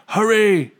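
loud, high hurry call; urgent shout. A narrator's reading. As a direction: urge them to run. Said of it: "he told the others".

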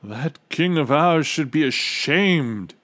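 loud, high, drawn-out scolding; outraged trumpeter. A narrator's reading. Trumpeter's outburst in story.

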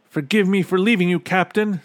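quick, high, loud apology; high boy's voice in a rush; hurried Bartholomew. A narrator's reading. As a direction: blurt it out urgently.

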